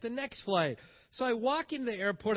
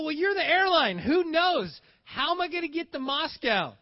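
loud, higher, frustrated demand at the airline desk; exasperated demand; 8 semitones higher, 6 dB louder than usual. Retold or imitated. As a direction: push back hard, fed up with them. Retold arguing with airline desk.

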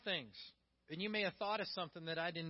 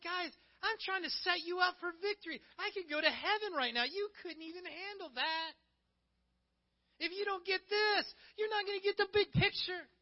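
high, raised, urgent voice; exhorting challenge; 11 semitones higher, 5 dB louder than usual. Acted. Jesus pleads with grumbling followers to understand, insistent and loud. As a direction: press them hard, pitched well up.

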